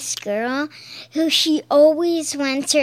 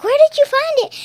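high, louder quoted question; imitating cartoon character; 13 semitones higher, 5 dB louder, much faster than usual. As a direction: pitch it up like a cartoon pony asking. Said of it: "then she's like".

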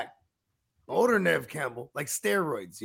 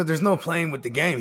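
normal talking voice

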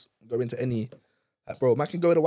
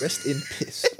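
mocking squeal; slower than usual. Mocking closed club.

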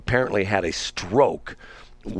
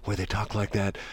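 softened public-radio voice, mocking; NPR voice; 6 dB quieter than usual. Host makes fun of the station's voice, softly. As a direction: drop to a hushed radio murmur, lightly parodied. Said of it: "the N P R voice".

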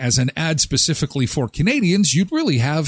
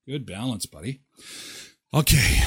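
usual speaking voice

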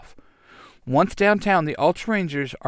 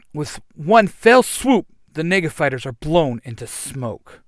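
ordinary delivery

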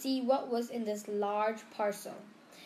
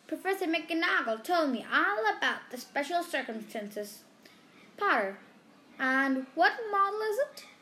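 higher, louder squeaky little professor; a tiny teacher asking eagerly; 6 semitones higher, 4 dB louder than usual. A narrator's reading. Flitwick eagerly asking Harry about the broom, high and squeaky.

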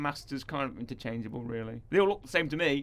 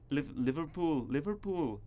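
trying a Scouse accent; 3 dB quieter, slower than usual. Shown as a demonstration. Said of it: "how can I do a Liverpudlian accent?".

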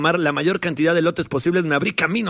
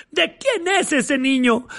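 nosy lady voice; 8 semitones higher, slower than usual. Acted. Ladies in the crowd voiced.

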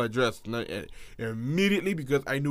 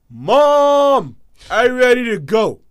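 loud, high plea to leave now; urgent plea; 9 semitones higher, 12 dB louder, much slower than usual. Retold or imitated. Son shaken by the street beating telling mom to leave, loud and high. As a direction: call out to mom that you are done here.

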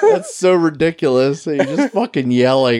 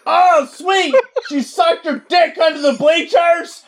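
mock yell; slower than usual. Constant yeller gossiping in the library, shouting. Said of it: "You're just yelling".

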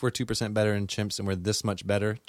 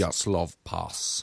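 slow, low mock Russian accent; Rocky-style Russian accent; 4 semitones lower, much slower than usual. Shown as a demonstration. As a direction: drag it out like a Russian tough guy. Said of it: "Oh, that's pretty good ... like a Rocky".

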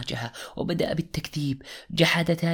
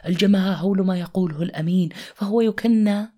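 building preacher's cry, louder and higher; rising preaching; 6 semitones higher, 5 dB louder than usual. Acted. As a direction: preach it up to a ringing height. Rising sermon climax.

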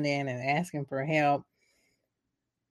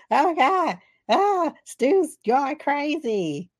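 high, slow panicked cry; hammed-up freakout; mock panic; 9 semitones higher, 3 dB louder, slower than usual. [name] panicking that Stu has gone crazy.

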